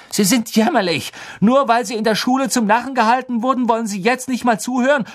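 high, loud, fast outburst; furious boy yelling at a teacher; outraged yelling. A narrator's reading. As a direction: shout your contempt in a rush. Harry shouting at Snape.

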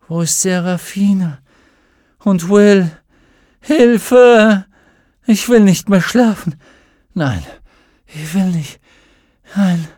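put-on high, loud panicked girl; frightened cry for help. A narrator's reading. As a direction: call out in fear. Mädchen wacht auf, ruft um Hilfe. (verängstigter Hilferuf)

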